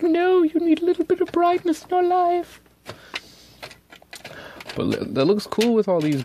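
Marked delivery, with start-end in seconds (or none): 0.0-2.6 much higher, louder put-on voice; chirpy mock voice. Mocking her own gray inks in a chirpy put-on voice. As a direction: chirp it like a pushy cheerful friend. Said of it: "It's like".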